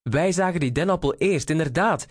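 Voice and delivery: high, quick chiming-in; echoing call. A narrator's reading. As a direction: call out in hurried agreement, pitched high. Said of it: "riep Eduard ook". (hoog en snel meegeroepen)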